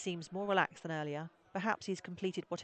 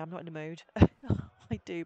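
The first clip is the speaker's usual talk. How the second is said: ordinary delivery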